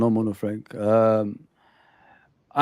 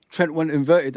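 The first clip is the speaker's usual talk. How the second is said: ordinary delivery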